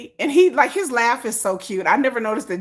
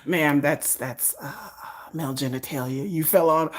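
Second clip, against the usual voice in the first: lower, softer, hesitant doctor voice; a doctor breaking awkward news in a lowered voice; 8 semitones lower, 5 dB quieter than usual. Acted. Doctor telling the patient awkward news, lightly lowered and hesitant.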